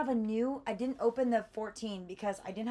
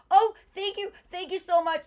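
high, loud, relieved gratitude; gushing thanks; 10 semitones higher, 9 dB louder than usual. Retold or imitated. To the kiosk man who found her bag, loud relieved thanks. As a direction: gush your thanks, much higher.

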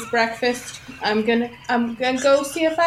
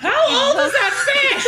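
very high, drawn-out incredulous question; shocked outcry. Acted. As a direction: squeal it slowly in disbelief. Startled reaction to dropped fish.

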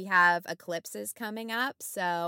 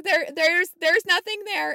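much higher and louder, panicky; frightened denial; 11 semitones higher, 10 dB louder than usual. Retold or imitated. Nurse backing away from the closet, high panicky reassurance. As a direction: blurt it out high, trying to convince yourself.

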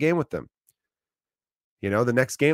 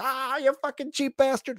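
much higher, slow jeering put-on voice; mocking crowd; 14 semitones higher than usual. Bar regulars jeering the cheap customer, high slow mocking voice. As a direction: razz him like the whole bar piling on.

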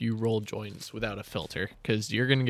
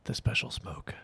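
hushed conspiratorial whisper; breathy secret aside; 5 dB quieter than usual. Pretend. Secretive aside about weed.

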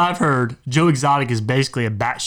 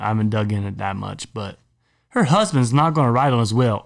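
ordinary talk

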